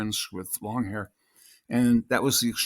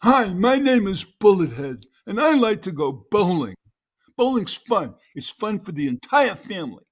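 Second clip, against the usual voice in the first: funny character voice; 9 semitones higher, 8 dB louder than usual. Shown as a demonstration. Actor demonstrates his audition for Bullethead, funny voice. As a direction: belt it out like a big dopey cartoon character. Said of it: "read this character in a funny voice".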